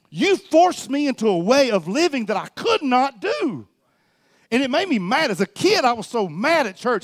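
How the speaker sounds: high, aggrieved outpouring; impassioned preaching. Real, not acted. Preacher recalling a church that forced him, impassioned preaching.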